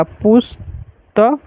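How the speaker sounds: पुस्तक drawn out very slowly, syllable by syllable; slow writing demo. Shown as a demonstration. Teacher writing on the board for students, very slow. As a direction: spell पुस्तक slowly as you write it. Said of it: "अनुस्वार दे के लिखना हो तो कैसे लिखाएगा".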